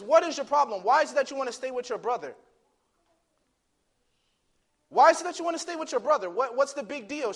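loud, high, rapid-fire questioning; exasperated father. Retold or imitated. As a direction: act out a dad demanding an answer. Father interrogates his daughter, exasperated.

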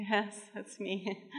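slow, very soft answer; retold hushed, worn-out patient; weary reply. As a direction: answer faintly, drained and reluctant.

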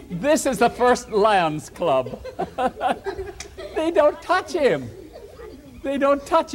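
chuckling through a pun; laughing at own joke. Real, not acted. Storyteller to children, laughing at his pun.

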